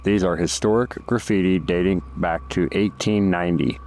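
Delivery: measured, informative guided-tour read; documentary narration. Acted. Documentary narration over cave footage.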